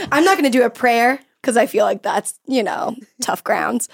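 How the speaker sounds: ordinary delivery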